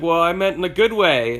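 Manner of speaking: imitating Lane backpedalling; teen's defensive reply. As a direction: protest it like a teen. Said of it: "And Lane's like".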